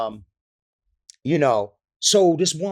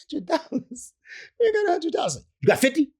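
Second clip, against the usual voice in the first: high, mock girlish protest; squeaky impression of young women; girls haggling. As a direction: whine it in a high put-on voice. Girls haggling over tickets.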